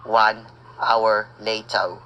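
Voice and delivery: slow, low time-skip announcement; narrator caption. A narrator's reading. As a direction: announce the time jump slowly.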